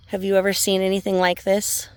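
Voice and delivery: ordinary talk, nothing marked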